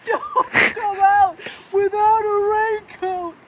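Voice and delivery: mock weather warning through laughter; high, slow, crying with laughter; laughing.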